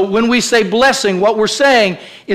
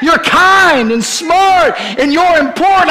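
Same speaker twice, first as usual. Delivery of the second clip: high, loud and slow, ringing out; preached affirmation; 8 semitones higher, 3 dB louder than usual. Acted. Preacher proclaiming the nanny's words, slow ringing call. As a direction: proclaim it over the room. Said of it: "Evelyn saying".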